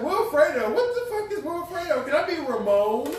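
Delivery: high, loud mock outrage; hammed-up indignant pleading; retold incredulous protest. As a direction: protest the name in high-pitched disbelief. Retelling outrage at assigned Spanish name.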